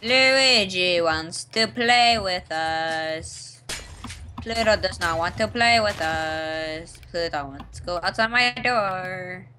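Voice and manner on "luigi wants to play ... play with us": slow sing-song. Real, not acted.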